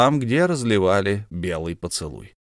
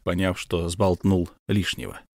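plain reading voice

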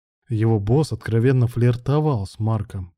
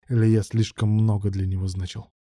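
usual speaking voice

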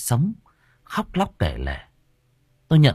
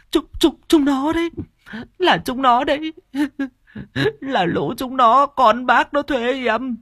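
weeping graveside plea. A narrator's reading. Woman confessing at the grave of old Cải, wailing plea. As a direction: sob and stammer as you beg the dead for forgiveness. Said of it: "nghe được mụ khấn".

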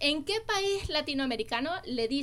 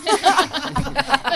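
real laughing fit, louder; loud burst of laughter; 8 dB louder than usual. Real, not acted. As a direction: break out laughing hard. Friends laughing at drug joke.

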